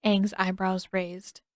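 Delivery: plain reading voice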